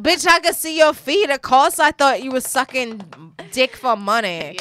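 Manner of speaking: loud, sassy, high comeback; sassy friend mocking the flip-flops; sassy retort. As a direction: throw it back loud and sassy. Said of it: "It was like".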